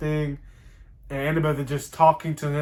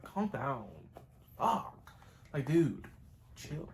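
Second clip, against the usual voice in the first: much softer, exasperated shushing; annoyed hushing; 3 semitones lower, 15 dB quieter, slower than usual. Retold or imitated. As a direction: tell him to cool it under your breath. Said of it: "and it's like".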